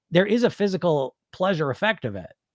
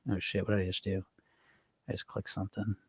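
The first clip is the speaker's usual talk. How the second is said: muttered aside; 7 semitones lower, 14 dB quieter than usual. Real, not acted. Flustered aside after a misclick.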